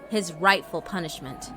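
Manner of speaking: ordinary delivery, nothing marked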